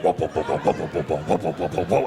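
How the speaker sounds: low, repeated pulsing sound effect; pulsating noise. Acted. Butthole pulsating in step as he walks, low throbbing noise. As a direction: pump out low, rhythmic throbs. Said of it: "pulsating on step with me".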